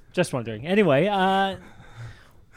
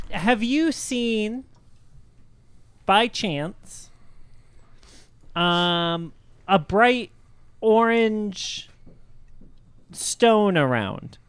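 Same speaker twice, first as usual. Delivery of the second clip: halting, higher-pitched character question; Geppetto pytający; 7 semitones higher, much slower than usual. Acted. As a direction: ask slowly and carefully, in pieces.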